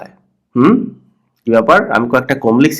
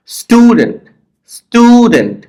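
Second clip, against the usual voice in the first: Stew high and long, dent falling away; stress on first syllable; 9 semitones higher, 3 dB louder, slower than usual. Shown as a demonstration. Teacher demonstrating word stress to learners, strong first syllable. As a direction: punch the stew of student at a raised pitch. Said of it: "এটাকে জোরে পড়তে হয়".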